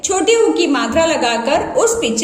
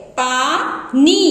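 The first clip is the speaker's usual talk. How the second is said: teacher drawing पानी out slowly; slowly drawn out; 2 semitones lower, much slower than usual. Shown as a demonstration. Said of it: "इसी तरह से".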